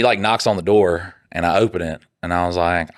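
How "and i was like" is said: ordinary delivery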